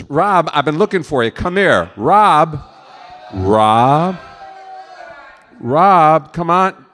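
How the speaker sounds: real holler to get someone's attention; calling out. Real, not acted. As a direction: shout for someone to come over. Calling out to Rob.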